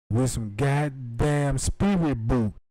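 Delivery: ordinary talk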